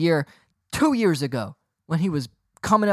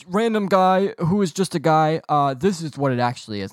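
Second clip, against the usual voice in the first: butting-in know-it-all; 5 semitones higher than usual. Pretend. Bus rider correcting some random guy, mock know-it-all. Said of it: "the type of person that just goes".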